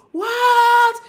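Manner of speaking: high, loud cry of what; loud indignant what; outraged cry. Retold or imitated. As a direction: cry out in stunned hurt.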